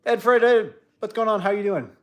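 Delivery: high, loud cheery hello; excited greeting. Real, not acted. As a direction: greet them with big excitement. Greeting chat viewer excitedly.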